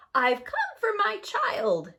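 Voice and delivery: very high, loud, slow cackling witch voice; laughing witch. A narrator's reading. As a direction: crow it triumphantly like a witch. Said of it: "she laughed".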